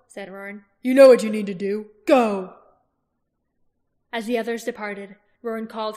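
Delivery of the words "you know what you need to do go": loud order to go; war leader shouting go; commanding shout. Acted. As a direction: send them off with a bark. Roran orders them off.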